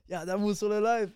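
excited fanboy shout; starstruck excitement. Retold or imitated. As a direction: call it out like a starstruck fan. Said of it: "je capotais".

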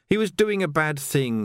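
ordinary delivery, nothing marked

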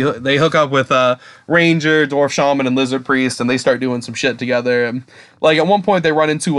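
ordinary talk, nothing marked

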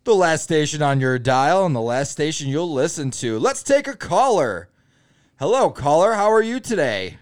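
bright, loud, upbeat radio host. Acted. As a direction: pump it up like a morning DJ. Parody radio DJ on air.

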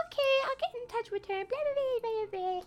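high, soft imitation of a receptionist; front-desk lady. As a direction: put on a small, high helpful-lady voice. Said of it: "And she's like".

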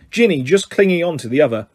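plain reading voice

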